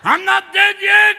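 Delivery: high, very loud slow protest; John Paul the Second. Acted. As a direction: cry out as a defiant old pope. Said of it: "Remember John Paul the Second?".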